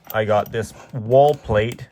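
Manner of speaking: ordinary talk, nothing marked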